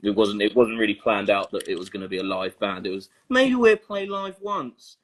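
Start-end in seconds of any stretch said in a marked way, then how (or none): 3.3-5.0 high, drawn-out imitation of a hopeful suggestion; mock tentative musing. Band member quoting their modest first plan, mock tentative. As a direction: float the idea, slow and high, starting loud. Acting out the band's first idea.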